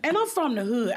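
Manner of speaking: ordinary talk, nothing marked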